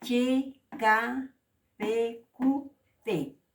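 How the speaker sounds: each consonant name said out slowly and loudly; Aymara consonants demo. Shown as a demonstration. Teacher sounding out consonants for Aymara students. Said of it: "Con el uso de las cinco consonantes".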